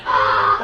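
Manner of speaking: high mock "aah"; imitated answering yell. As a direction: mimic the rival screaming back. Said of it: "And he was like".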